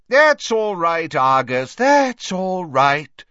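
loud, high, drawn-out reassurance; Slughorn, jovially placating. Acted. Merry host calms the angry caretaker, loud jovial reassurance. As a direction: wave away the fuss loudly and cheerily. Slughorn waves off Filch's complaint.

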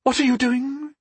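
very high, anxious question; alarmed goddess, pitched right up; shaking voice. A narrator's reading. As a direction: ask it high and uneasy. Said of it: "asked in a shaking voice".

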